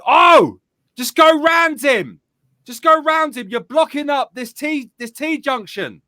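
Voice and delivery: very high, full-voiced yell; loud retold roadside bellow; shouting down a hill. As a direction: holler at drivers far below, fading a little at the end. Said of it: "shout down the hill as loud as I can".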